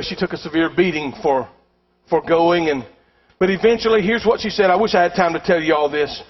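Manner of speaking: ordinary delivery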